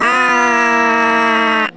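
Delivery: loud strangled scream; witch's dying scream. Acted. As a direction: shriek as the witch dies. Witch is killed.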